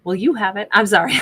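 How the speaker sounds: usual speaking voice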